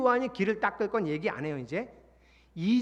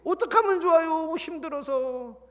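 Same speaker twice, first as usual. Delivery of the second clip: worried neighbor; 10 semitones higher, 7 dB louder than usual. Retold or imitated. Others worrying aloud.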